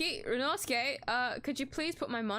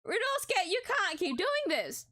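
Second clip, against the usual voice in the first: much higher, louder plea; pleading shout; 7 semitones higher, 4 dB louder than usual. Acted. Susato crying out to Ryunosuke, high loud plea. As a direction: cry out to stop him.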